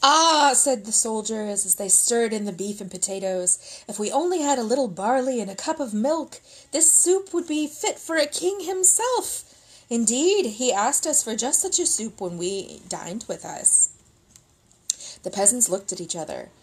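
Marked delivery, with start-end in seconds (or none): 0.0-0.5 savoring soldier. Acted. 3.9-13.9 high, grand hinting voice; boastful soldier. Acted. Soldier hinting to peasants about royal soup, high and grand.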